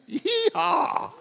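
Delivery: high, loud joyful whoop; joyful yee-haw. Real, not acted. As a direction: whoop it out with real joy. Celebrating wife's salvation.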